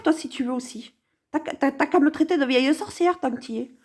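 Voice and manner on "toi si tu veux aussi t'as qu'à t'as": ordinary talk, nothing marked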